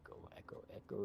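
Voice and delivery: very soft, whispered murmur; murmuring to self. Real, not acted. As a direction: whisper it to yourself while searching. Searching for a name, to self.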